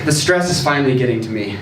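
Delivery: usual speaking voice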